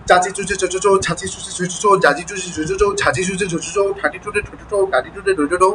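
nonstop rapid-fire syllable run; very fast drill. Shown as a demonstration. Teacher demonstrating reading twenty times without pausing, very fast reading. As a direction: rattle through every line with no break. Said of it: "বরং খুব দ্রুত".

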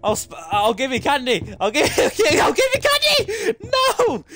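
high frantic pleading shrieks; panicked shrieking. Real, not acted. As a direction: beg shrieking, high and loud. Panicked begging in chase.

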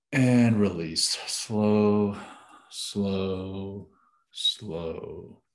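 slow release, fading away word by word; elongated exhale cue, trailing down; drawn-out slow. Real, not acted. Pacing the final slow out-breath.

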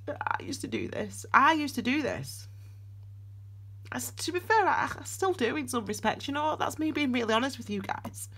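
high, tearful, choked confession; real tears, urging them gently; crying through the words, voice breaking high. Real, not acted. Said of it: "me crying".

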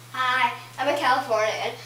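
put-on playful surfer-girl Californian voice; Californian accent. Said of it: "I'm gonna be Californian".

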